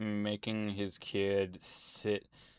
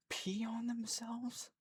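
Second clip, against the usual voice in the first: shocked whisper; 12 semitones higher, 10 dB quieter, slower than usual. Real, not acted. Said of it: "whispered in disbelief".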